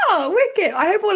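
high delighted squeal of a reply; very high-pitched. Real, not acted. Co-host pleased at being called good, very high-pitched. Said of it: "That was very high pitched".

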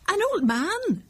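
high-pitched woman's echoing question; puzzled surprise. Acted. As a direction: repeat it back, taken aback. Mother repeats the question, puzzled.

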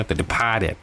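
softly tried Boston pronunciation of Departed; Boston accent. Shown as a demonstration. Host echoing the film title, light Boston accent. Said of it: "That's from Boston accent".

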